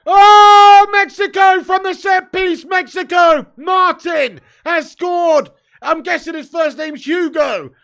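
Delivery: loud, high, slow roaring goal call; goal celebration shout. Real, not acted. Streamer screaming as Mexico score, loud and excited. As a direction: bellow it like a commentator at a goal.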